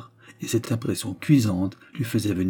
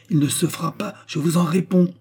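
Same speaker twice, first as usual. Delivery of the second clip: higher-pitched, emphatic refusal, growing louder; firm, raised-pitch vow; defiant refusal; 8 semitones higher, 3 dB louder than usual. A narrator's reading. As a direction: stand up to a parent. Daughter defies her mother.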